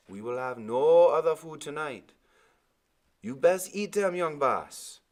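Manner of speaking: ordinary delivery, nothing marked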